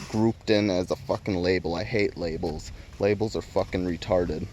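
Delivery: ordinary talk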